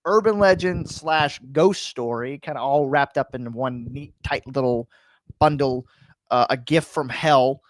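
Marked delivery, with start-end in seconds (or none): none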